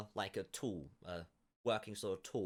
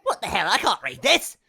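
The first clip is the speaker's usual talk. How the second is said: much louder, higher outburst; exasperated old farmer; 10 semitones higher, 13 dB louder than usual. Acted. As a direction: blurt out in frustration. Farmer can't read the note.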